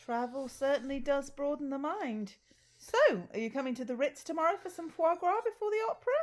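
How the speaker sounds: posh snob voice. Acted. Sketch character, posh snob.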